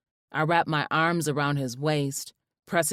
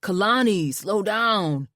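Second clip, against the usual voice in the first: louder, higher call; calling after; 4 semitones higher, 4 dB louder than usual. A narrator's reading. Husband calling after his excited wife, louder. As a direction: call after her as she runs. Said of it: "he called after me".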